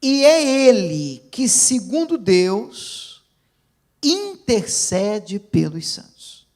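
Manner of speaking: slow, declamatory verse, loud at the start; forceful pulpit reading; proclaiming scripture. Real, not acted. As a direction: open strong, then measure out each phrase.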